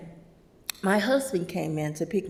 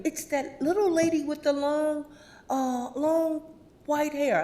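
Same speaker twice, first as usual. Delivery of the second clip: higher voice of a customer; customer voiced; 8 semitones higher than usual. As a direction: describe her like a puzzled shopper. Customer describing Sherry.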